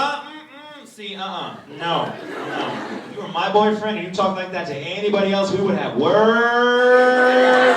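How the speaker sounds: loud mock warning, last word drawn out; smitten clerk's warning. Clerk tells guest off for that voice, mock-stern warning. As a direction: imitate a clerk warning a would-be boyfriend. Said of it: "She's like".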